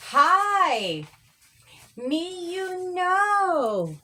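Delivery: lightly voiced dad calling through the door. Dad calls through the door to the family inside, lightly voiced and drawn out.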